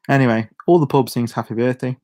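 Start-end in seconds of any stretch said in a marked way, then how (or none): none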